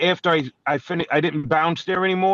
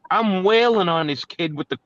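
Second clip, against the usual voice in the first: normal talking voice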